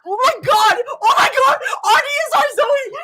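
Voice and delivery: high, loud excited shouts; thrilled high yelling; real yelling. Real, not acted. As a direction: shout it in fan hysteria. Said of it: "I'm yelling way too much".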